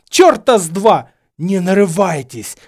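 high, very loud retort; angry shout. A narrator's reading. Quarrelling pupil and professor, shouting angrily. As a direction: hurl it back in fury.

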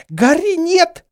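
very high, loud scream; panicked high yell. A narrator's reading. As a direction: scream to stop him. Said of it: "завопила Гермиона".

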